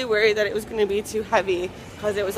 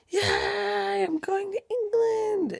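excited; 7 semitones higher, much slower than usual. Real, not acted. Excited about trip.

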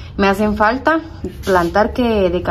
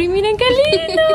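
high squeal. Real, not acted. Showing Diana's pots.

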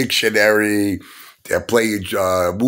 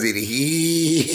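mocking imitation laugh; high, stretched-out fake snicker; Gary Gensler; 6 semitones higher than usual. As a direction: mimic a man laughing, drawn out. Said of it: "he's like laughing".

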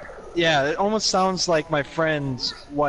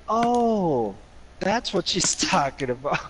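retold realisation; 3 semitones higher, slower than usual. Retelling working out which show was meant, dawning realisation. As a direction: act out the penny dropping.

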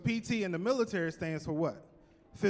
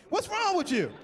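higher worked-up outburst; real exasperation; 10 semitones higher than usual. Real, not acted. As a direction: get worked up at the screen. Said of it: "Get real emotional about that".

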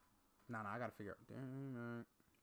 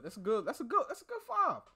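rising from normal to much higher and louder; building excitement; 11 semitones higher, 7 dB louder than usual. Real, not acted. Cohost getting more hyped about a top five list. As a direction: build it up with each repeat.

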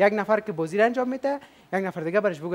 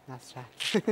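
much softer, imitating a shy little reply; very quiet answer; 5 semitones lower, 9 dB quieter, much slower than usual. Host imitating the shy contestant's very quiet answer. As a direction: say the name softly, like the friend did. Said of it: "دوست ما بسیار آرام گفت".

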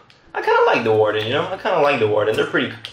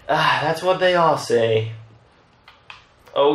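ordinary talk

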